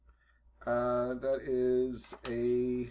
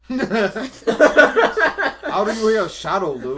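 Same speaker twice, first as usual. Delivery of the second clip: laughing; 9 semitones higher, 14 dB louder, much slower than usual. Real, not acted. Teasing a friend's silly line.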